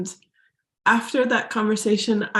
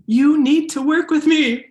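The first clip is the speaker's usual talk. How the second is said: urgent insistence; 6 semitones higher, 6 dB louder than usual. Retold or imitated. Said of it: "basically like".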